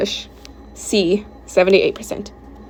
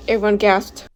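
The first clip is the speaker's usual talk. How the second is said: ordinary delivery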